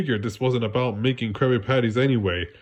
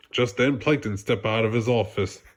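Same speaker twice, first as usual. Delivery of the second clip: ordinary delivery, nothing marked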